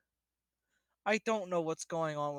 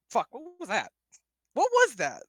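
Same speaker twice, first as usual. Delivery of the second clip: louder and higher, caught off guard by his own voice; startled outburst; 7 semitones higher, 7 dB louder than usual. Real, not acted. Player stunned by his own botched accent, shocked. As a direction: blurt it out, shocked at what just came out.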